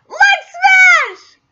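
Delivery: high, loud attack cry; battle shout. Acted. Deku shouting his attack, high and loud. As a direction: throw the punch with your voice. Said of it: "Manga Nerdigan live reaction".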